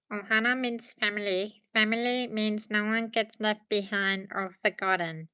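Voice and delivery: a hint of the little alien saying his famous line; Stitch impression. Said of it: "I'm gonna do, like, the Ohana means family".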